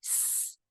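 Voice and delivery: a long hissing "s" with no voice; breathed-out "s" sound. Shown as a demonstration. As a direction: hiss the "s" like a snake. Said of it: "un son qui fait".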